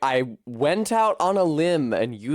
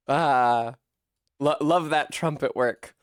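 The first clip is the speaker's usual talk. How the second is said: ordinary delivery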